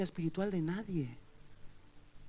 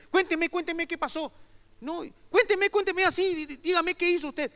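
insistiendo desesperada; 11 semitones higher, 7 dB louder than usual. Retold or imitated. La madre insiste al evangelista.

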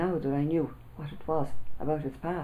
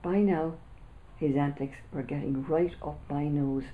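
ordinary delivery

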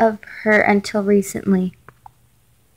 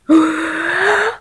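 gasp; 6 semitones higher than usual. Real, not acted. Reading alarming text, gasps.

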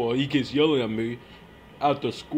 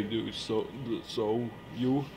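normal talking voice

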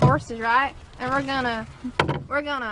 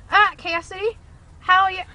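put-on country accent; 3 semitones higher than usual. Friend greets Cassidy in a pretend country accent. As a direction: do a playful country accent, a laugh breaking in. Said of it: "I'm also gonna do a country accent".

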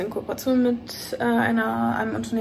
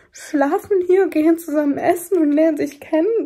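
excited; 3 semitones higher, 7 dB louder than usual. Real, not acted. Gushing about strangers meeting up.

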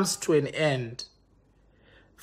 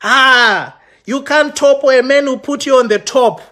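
higher, mock-indignant imitation; mocking boast; 5 semitones higher, 4 dB louder than usual. Mimicking the man's social media boasting, hammed-up. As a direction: parody his self-important posting. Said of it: "you go on social media like".

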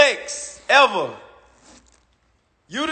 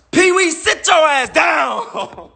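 shouted put-down; 5 semitones higher, much slower than usual. Acted. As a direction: bellow it like telling off a heckler. Yelling at [name].

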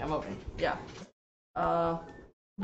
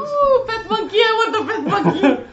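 excited squeal; 12 semitones higher, 8 dB louder than usual. Real, not acted. Excited over pet monkey.

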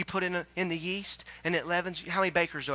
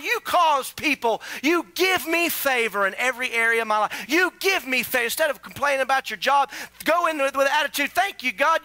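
high, loud preaching build; full-force preaching; 9 semitones higher, 9 dB louder than usual. Acted. Pastor preaching hard to his church, loud and high. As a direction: drive it home to the congregation.

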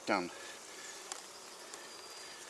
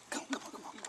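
hushed, voiceless urging under the breath; quick little whispered pleas; urgent coaxing; 12 semitones higher, 10 dB quieter, much faster than usual. Real, not acted. Beekeeper coaxing his bees to move, under his breath.

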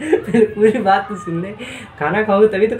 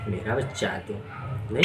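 tender cooing; 9 semitones lower, 13 dB quieter than usual. Real, not acted. Cooing to pet bird.

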